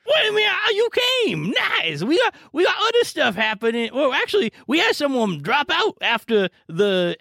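put-on high old granny voice, chatty; a cheerful old lady explaining the plan; Grandma Moldy. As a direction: GM plays a chatty granny host.

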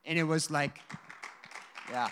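usual speaking voice

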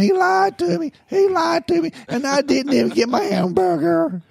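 high wailing sob, hammed up; mock crying wail. Woman in handcuffs wailing at the cop in a high mock cry. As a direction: wail it high like a crook caught out. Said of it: "squalling and screaming".

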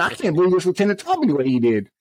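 retold indignation. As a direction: replay your upset, pitch lifted. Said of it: "I'm mad now".

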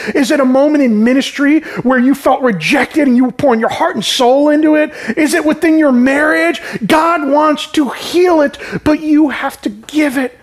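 preacher pressing the point home; impassioned preaching. Real, not acted. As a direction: build to a fervent plea to the congregation. Preaching builds to an appeal.

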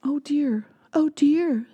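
lightly voiced worried mother; fretting mother mouse. Mother mouse frets over crying baby, mild worry. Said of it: "said Mother Mouse".

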